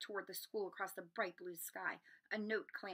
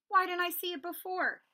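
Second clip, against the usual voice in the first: higher, louder burst of realization; excited realization; 10 semitones higher, 8 dB louder than usual. Acted. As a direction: it suddenly clicks. Harry's realization.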